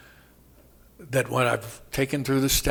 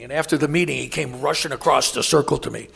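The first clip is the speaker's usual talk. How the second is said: ordinary talk, nothing marked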